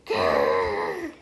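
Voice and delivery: deep throaty growl of anger; angry growl. Acted. Angry girl growls at her sister for taking her clock. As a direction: growl like smoke is coming out of your ears. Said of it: "so ticked off".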